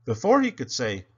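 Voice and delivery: ordinary delivery, nothing marked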